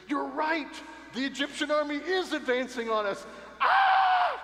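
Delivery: high, loud panicked cry ending in a scream; hammed-up terrified Moses; mock panic. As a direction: pretend panic shriek. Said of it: "if Moses panics as well".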